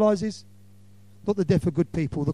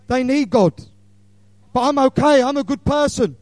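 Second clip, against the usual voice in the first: smug self-justifying voice; 7 semitones higher, 9 dB louder than usual. Pretend. Imagined smug sinner's excuse.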